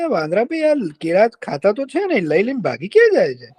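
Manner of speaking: farmer's lightly voiced puzzled question; puzzled wonder. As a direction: muse in curious disbelief. Farmer wonders where monkey goes.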